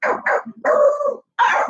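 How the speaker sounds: short barks into high howls; bay like a hunting beagle; imitated alarm bark. Beagle alerting neighborhood dogs to cat, imitated baying. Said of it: "he sent out an alarm".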